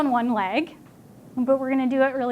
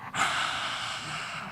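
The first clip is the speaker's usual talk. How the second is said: lion's breath exhale; 11 dB quieter than usual. Shown as a demonstration. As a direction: roar the breath out like a lion. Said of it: "I'll show you first".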